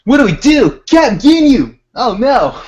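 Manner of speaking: high loud panicked cries; hammed-up cartoon panic; mock panic. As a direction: play cartoon alarm. Acting panicked cartoon scene.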